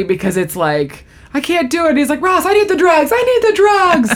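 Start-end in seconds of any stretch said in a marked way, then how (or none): 1.3-1.9 high, strained complaint; mock agony. Joey with kidney stones, mock agony. 2.2-4.1 very high, desperate mock pleading; Joey. Joey begging Ross for painkillers, desperate.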